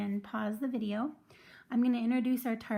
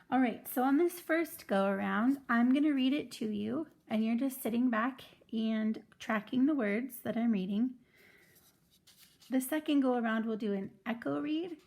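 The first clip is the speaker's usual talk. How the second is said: normal talking voice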